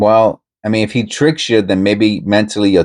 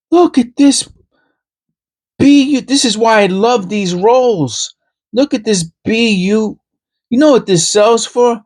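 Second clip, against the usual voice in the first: imitating the YouTuber; 13 semitones higher than usual. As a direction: ham up a coin hunter showing off his find. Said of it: "he'll do something like this".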